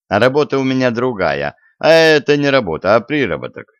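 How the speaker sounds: ordinary delivery, nothing marked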